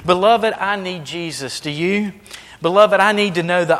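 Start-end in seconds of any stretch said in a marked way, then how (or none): none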